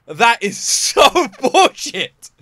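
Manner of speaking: slow, laughing through the words; laughing disbelief. Real, not acted.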